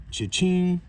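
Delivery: high, bright, punchy cash-register cheer; excited cha-ching. Acted. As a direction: sing it out like a jackpot win. Cash register celebration sound.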